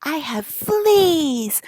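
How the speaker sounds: drawn-out strange delivery of the father; hammed-up imitation of dad; father's odd line. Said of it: "and he was like".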